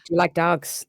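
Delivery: light imitation of a film Irish accent; Brad Pitt's Irish accent. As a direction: try a rough movie-style Irish accent. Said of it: "The Brad Pitt accent".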